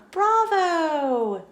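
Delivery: high, ringing bravo; a friend cheering. Acted. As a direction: applaud the dancer.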